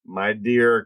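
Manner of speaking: normal talking voice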